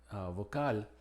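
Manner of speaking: ordinary talk, nothing marked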